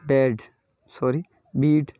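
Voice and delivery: ordinary delivery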